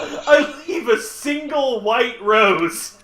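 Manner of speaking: high, slow, through laughter; laughing. Real, not acted. Killer describing his calling card, cracking up. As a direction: struggle to get the words out while laughing.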